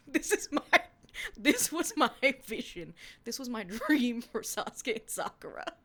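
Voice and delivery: loud laughing gush. Real, not acted. Laughing excited about her vision.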